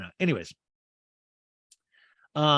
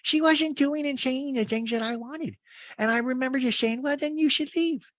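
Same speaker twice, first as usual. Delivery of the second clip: very high put-on voice; Rinna; 12 semitones higher than usual. Housewife on FaceTime defending herself, high-pitched mimicry. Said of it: "Rinna's on FaceTime with Kyle, goes".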